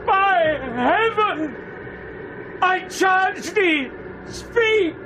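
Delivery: loud, high, ringing challenge; commanding shout. Acted. Horatio challenging the ghost to speak, commanding shout.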